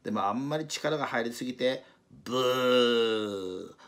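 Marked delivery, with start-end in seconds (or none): 2.2-3.8 drawn-out loud buzzing fart; fart noise. Acted. Takasaki straining at the rope, a long loud fart noise. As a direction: blow a long comic raspberry. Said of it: "おならが出てしまいました".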